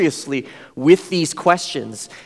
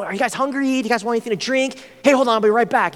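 higher, keen offer of drinks; eager host; 6 semitones higher, faster than usual. Retold or imitated. Host offers snacks to the students, higher and keen. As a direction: play the generous host. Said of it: "super nice".

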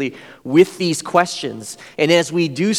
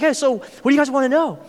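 higher, open question; friendly host; 9 semitones higher, faster than usual. Retold or imitated. Host opens the conversation to the students, higher and friendly. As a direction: invite them to ask. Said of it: "Then he's like".

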